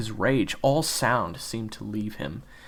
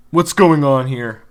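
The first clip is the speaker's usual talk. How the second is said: stern teacher; 4 semitones higher, 14 dB louder, slower than usual. Acted. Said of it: "a mature voice asked".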